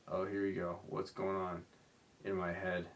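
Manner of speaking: ordinary talk, nothing marked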